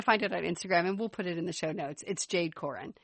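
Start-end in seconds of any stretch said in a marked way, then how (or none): none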